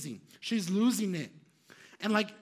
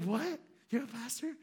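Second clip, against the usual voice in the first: high-pitched incredulous question; mock astonishment; 4 semitones higher, 5 dB quieter than usual. Crying woman learns the stranger is a pastor, astonished. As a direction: imitate her stunned, rising disbelief.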